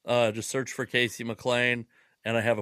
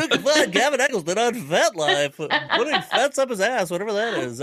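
announcer voice; 7 semitones higher, 3 dB louder than usual. Pretend. Mock-announces Kevin's FetLife plug.

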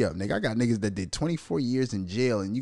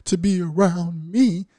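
mock positive-vibes guru; 6 semitones higher, 4 dB louder than usual. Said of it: "I don't do".

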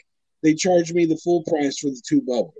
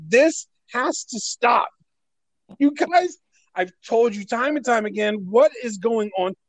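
high, emphatic complaint with deliberate start; retold exasperated demand; 5 semitones higher than usual. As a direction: lay down the law, exasperated. Acting out confronting the store.

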